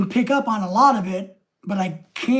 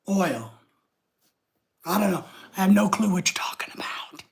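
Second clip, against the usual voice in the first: usual speaking voice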